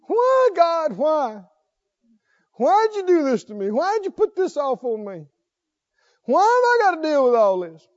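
high mock whine, why God why; whining at God. Preacher imitates a believer whining at God in a high voice. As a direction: play the self-pitying complainer, hammed up.

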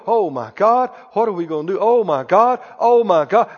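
lightly imitated worrier, pitched a little low; mock fretting. Preacher showing how not to react to bad reports, mock fretting. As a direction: mimic someone fretting over bad news, half-hearted. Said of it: "You don't go".

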